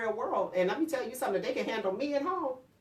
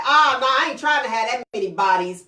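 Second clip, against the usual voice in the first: lightly voiced son, louder and casual; a teen brushing it off; imitating son; 9 dB louder than usual. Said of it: "My son was like".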